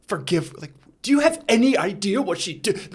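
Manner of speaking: retold outburst.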